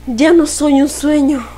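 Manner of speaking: measured, solemn recital; poem recitation. Acted.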